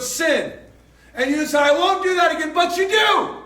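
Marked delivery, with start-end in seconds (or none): 1.6-3.4 higher and louder, building to a shout on do; rising shout. Acted. Preacher calling out the congregation, shouting. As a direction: hammer home that you do it anyway. Preacher mocks broken vow.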